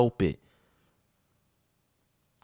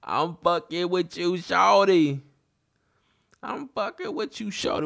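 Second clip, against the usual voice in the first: high-pitched playful put-on voice; joking, pitched way up; playful teasing; 7 semitones higher than usual. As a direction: tease in a silly, much higher voice. Playful teasing after his laugh.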